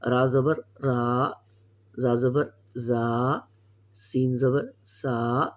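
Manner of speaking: slow, stretched syllables, each vowel held long; slow reading drill. Shown as a demonstration. Teacher reading letters aloud for students to copy, slowly. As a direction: spell out را, زا, سا slowly with a drawn آ. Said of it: "میں بیچ بیچ میں سے کچھ کچھ پڑھ دیتا ہوں".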